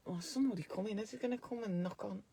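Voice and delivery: a muttered aside, barely voiced; hushed aside. Real, not acted.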